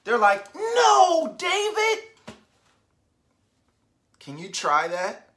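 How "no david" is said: emphatic No David. Shown as a demonstration. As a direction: shout it with emphasis. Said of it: "someone's saying it with emphasis".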